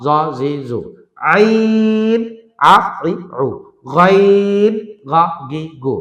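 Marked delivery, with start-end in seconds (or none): 1.3-2.2 আইন high, held long on a level tone; drawn-out letter name. Shown as a demonstration. Teacher naming a letter for learners, drawn out. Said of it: "টেনে বলতে হবে". 4.0-4.7 গাইন high, loud and held long; drawn-out letter name. Shown as a demonstration. Teacher naming a letter for learners, drawn out. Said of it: "টেনে বলতে হবে".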